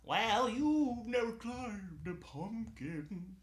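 high, slow silly vampire; ridiculous vampire voice. Acted. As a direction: ham up a goofy vampire. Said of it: "trying to do a ridiculous vampire voice".

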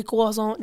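"croissant" as kwah-ZOH, no r; French-ish "croissant" with silent t; playful French croissant. Shown as a demonstration. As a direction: say "croissant" mock-French for fun, kwah-zohn. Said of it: "just for the fun of it".

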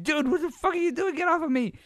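high, loud squawk of protest; hammed-up high-pitched freak-out; mock outraged yell. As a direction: yell as if fighting off someone who grabbed you. Acted protest of grabbed fan.

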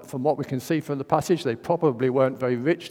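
normal talking voice

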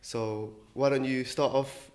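ordinary talk

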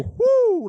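high swooping whoo sound; rising whoo. Retold or imitated. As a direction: sweep the voice up high like a brow arch. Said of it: "Ken's eyebrows go".

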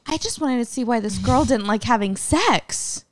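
high-pitched mock self-justification; hammed-up, high, aggrieved retelling; pretend indignant heckler. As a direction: play the heckler defending herself. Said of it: "how she tells the story".

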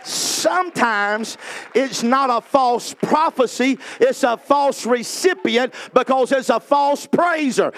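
slow, high preacher's proclamation; full-force preaching. Acted. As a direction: stretch each phrase out for the congregation.

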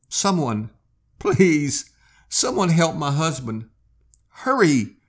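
high, loud pleading cry; desperate scream for help. Acted. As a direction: beg the crowd to save her husband. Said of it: "Her scream tore through the onlookers".

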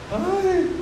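high, soft moan of alarm; mock frightened "ay". Peter feels the wind full on him, small alarmed moan. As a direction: let out a small acted "ay".

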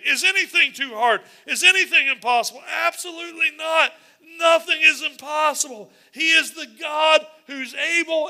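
high, loud preaching shout. Real, not acted. Pastor answering his own question with a loud, high shout. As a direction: drive it home at full force.

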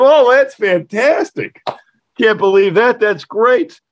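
high, slow, gushing delight; mock-gush over the teacher's news. Telling the shop teacher his furniture survives, excited.

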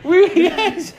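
very high, loud French babble; hammed-up, squealing French through laughter; comic high-pitched agreement; mock French outburst. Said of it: "I'm fucking speaking French".

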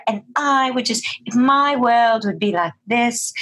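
high-pitched put-on British transatlantic accent. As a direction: imitate a film heroine's transatlantic British accent. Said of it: "make it as transatlantic as possible".

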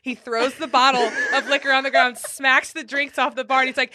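usual speaking voice

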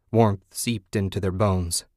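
ordinary delivery

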